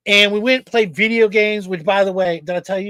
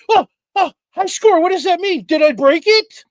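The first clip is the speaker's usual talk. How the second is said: lightly voiced innocent kid, higher; mock naive gamer; 7 semitones higher than usual. Son at the arcade, faking innocence, lightly voiced higher. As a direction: play dumb about the high score. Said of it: "like the scene from Grandma's Boy".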